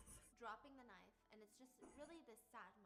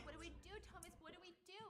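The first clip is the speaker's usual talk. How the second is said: panicked pleading; 9 semitones higher, 5 dB louder, faster than usual. Acted. Pippa panicking.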